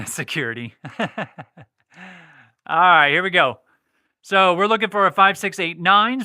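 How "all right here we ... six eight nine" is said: louder dealer calling the numbers; craps table caller. Acted. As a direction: call the point numbers like a croupier. Calling the next roll.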